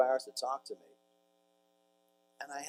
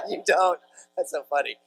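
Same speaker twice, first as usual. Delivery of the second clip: high, loud laugh running into the words; real amused laughter; 7 semitones higher, 12 dB louder than usual. Real, not acted. Speaker amused by someone in the audience, laughing as he talks. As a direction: laugh while you answer, pitched up.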